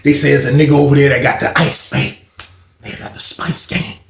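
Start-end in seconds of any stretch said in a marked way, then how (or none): 2.8-4.0 whispered secret; hushed. Retold or imitated. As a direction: keep it under your breath like contraband talk. Hushed news of smuggled drugs.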